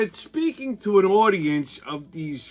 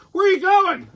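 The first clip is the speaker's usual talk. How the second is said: high, loud mock question from the organizer; 12 semitones higher, 4 dB louder than usual. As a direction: impersonate the flustered organizer. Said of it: "he stops me".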